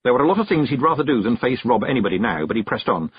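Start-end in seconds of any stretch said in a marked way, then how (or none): none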